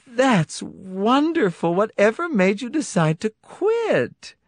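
very high, slow delight; delighted wife voice. Acted. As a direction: gush with pleased surprise. Cindy voiced by narrator.

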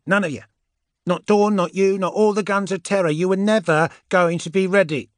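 high, loud, scornful character voice; fierce witch berating a soldier; Andromeda, vehement. Acted. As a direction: hammer it home, high and forceful.

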